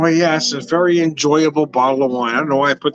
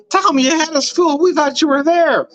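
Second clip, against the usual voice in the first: much higher, teasing mock cry; mock viewer voice; 12 semitones higher than usual. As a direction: play a fooled viewer, hammed-up. Mock viewer reply, high.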